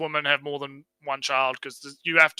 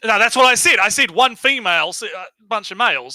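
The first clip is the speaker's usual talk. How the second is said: raised, exasperated correction; 8 semitones higher, 7 dB louder than usual. Real, not acted. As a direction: insist loudly, a bit frustrated. Frustrated correction in argument.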